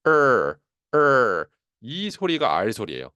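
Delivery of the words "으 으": "으" as a long "er" with only slight breath; louder, solid "으", tongue pulled back; voiced R sound. Shown as a demonstration. Teacher demonstrates R with mostly voice, "으" held and falling. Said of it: "살짝 얻는 정도로 해서".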